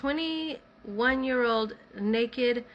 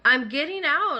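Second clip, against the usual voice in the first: raised, high retold protest; exasperated protest; 7 semitones higher, 6 dB louder than usual. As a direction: push back, louder and higher. Retold protest while being yanked.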